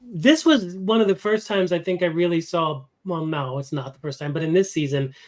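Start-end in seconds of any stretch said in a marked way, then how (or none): none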